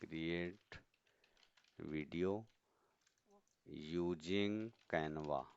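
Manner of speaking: drawn-out words with gaps between them; slow dictation. Real, not acted. Teacher noting the video step for students, slow dictation. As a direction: say each word as you write it.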